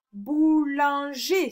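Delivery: slow "Boulanger" in three beats; syllable by syllable. Shown as a demonstration. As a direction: separate each syllable of "Boulanger". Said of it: "sur la troisième syllabe". (« Boulanger » découpé lentement en trois syllabes)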